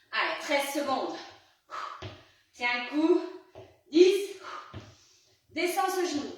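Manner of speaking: loud, high hype countdown; gym bro. Acted. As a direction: fire them up to the last second. Countdown, pushing hard.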